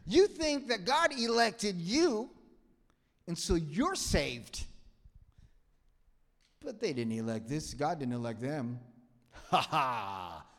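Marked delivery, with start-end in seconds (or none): 0.1-4.6 higher, louder and slow, puffed up with pride; mock self-important elect. Mocking the elect. 9.5-10.5 much louder, crowing boast; gloating mock boast. Gloating self-righteous believer.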